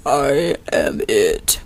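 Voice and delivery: very low, slow, drawn-out voice; too low a voice. Shown as a demonstration. Girl showing casting hopefuls the too-low voice, very low and slow. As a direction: drop way down and drag it out as the wrong example. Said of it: "not like".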